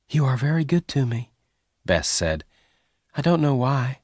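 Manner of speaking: a lightly voiced loving young woman; tender young woman. A narrator's reading. Bess voiced with soft music.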